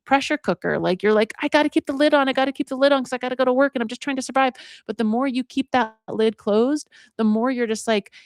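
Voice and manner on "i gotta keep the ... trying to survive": high, rushed voice of someone barely coping; frantic self-talk. Acted. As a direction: voice a mildly panicked inner monologue, pitched up. Voices someone frantically holding feelings in.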